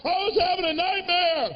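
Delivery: put-on teen caught by his mom; panicked excuse. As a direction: blurt it out to stop her talking. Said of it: "you're like".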